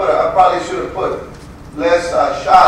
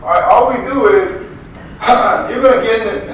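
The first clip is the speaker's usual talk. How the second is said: usual speaking voice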